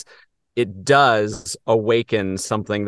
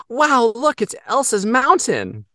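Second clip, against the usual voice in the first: put-on high, awed little girl; wide-eyed child gasping at a mountain; 13 semitones higher, 3 dB louder, slower than usual. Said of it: "my little niece innocently goes".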